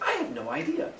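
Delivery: high, soft clueless mother voice; a high soft goofy Mother Earth; high silly voice. Retold or imitated.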